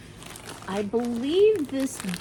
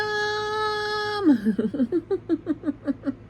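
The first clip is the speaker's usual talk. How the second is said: laughing; 13 semitones higher than usual. Real, not acted. Laughs at own cow joke.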